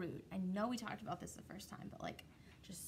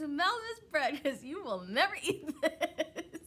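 higher, louder, laughing tease; mock-gloating hen; sassy taunt; 5 semitones higher, 7 dB louder than usual. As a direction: taunt them as you gloat. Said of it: "She's like".

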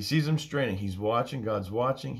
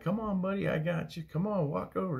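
high, encouraging parent voice; coaxing a toddler; 9 semitones higher than usual. Acted. Parent to a toddler learning to walk, high coaxing. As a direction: beckon a little one to take first steps. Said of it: "They're two years old".

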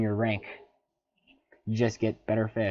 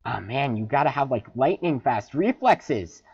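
ordinary talk

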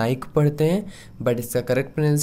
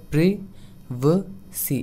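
Privacy drawn out, each sound stretched; very slow demo; 3 semitones higher, much slower than usual. Shown as a demonstration. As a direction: stretch privacy slowly for the learner. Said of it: "आप नीचे लिखा हुआ देख सकते हैं".

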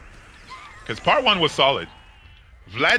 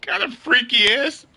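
shocked victim; 7 semitones higher than usual. Retold or imitated. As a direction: cry out like a horrified victim, laughing. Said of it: "victims for Lester are gonna be like".

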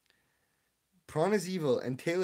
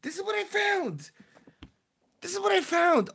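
exasperated; 13 semitones higher than usual. Real, not acted. Showing confusing search results.

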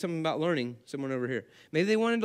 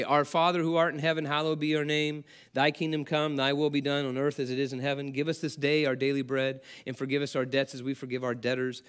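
reciting the Lord's Prayer, lightly prayerful; prayer recitation; 5 semitones lower than usual. Acted. Pastor reciting the prayer to the congregation, lightly prayerful. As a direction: lead the congregation through the prayer.

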